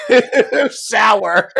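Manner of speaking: giggling through the words; laughing. Real, not acted. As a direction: laugh while you say it.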